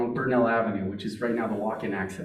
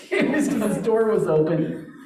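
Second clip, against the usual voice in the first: higher, laughing through the words; talking through real laughter; 9 semitones higher, 4 dB louder than usual. Real, not acted. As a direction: let the laugh carry the line. Laughing while telling the mishap.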